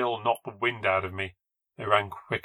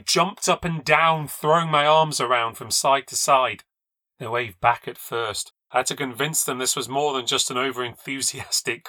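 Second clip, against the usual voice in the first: ordinary delivery, nothing marked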